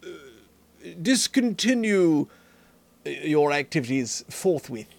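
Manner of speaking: a hint of an overly polite aristocrat, taking his time; royal foppish British voice. Shown as a demonstration. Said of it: "my royal f-, extra foppish voice".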